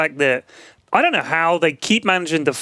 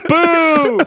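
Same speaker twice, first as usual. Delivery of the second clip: loud booing; mock boo; 9 semitones higher, 5 dB louder than usual. Booing the tiny church.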